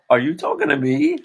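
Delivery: high, loud mocking question; mock incredulous. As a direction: squeal it as if outraged to be addressed. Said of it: "uh, you're like".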